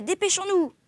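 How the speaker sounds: high, urgent call; shouted order. A narrator's reading. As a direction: raise the pitch and hurry them. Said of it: "Le capitaine criait".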